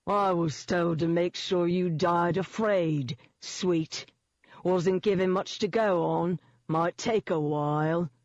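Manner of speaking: unhurried London gangster drawl; seedy London accent. A narrator's reading. Demon thug savours the coming kill, seedy London accent. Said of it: "in a seedy London accent".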